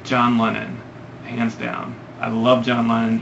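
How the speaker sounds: ordinary talk, nothing marked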